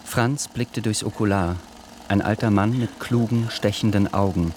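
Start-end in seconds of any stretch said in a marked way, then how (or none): none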